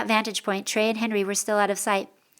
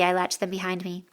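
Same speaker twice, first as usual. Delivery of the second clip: ordinary delivery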